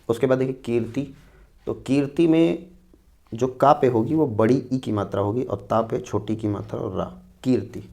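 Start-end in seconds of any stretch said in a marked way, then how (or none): none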